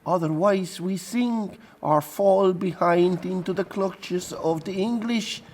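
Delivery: very high, frightened pleading; pleading pilot. Acted. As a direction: beg for your neck in a thin, high voice. Pilot pleads for his life.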